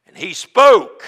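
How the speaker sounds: loud declaration of God speaking; booming proclamation. Acted. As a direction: boom it out like thunder. Preacher booming climax.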